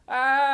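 long high loud scream. Acted. Characters screaming as van flies, loud scream. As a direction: scream in terror.

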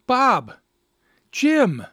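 high, loud cries of recognition; shocked exclamation. A narrator's reading. Two pals meeting as husband and intruder, shocked shouts. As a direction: blurt each name in astonishment.

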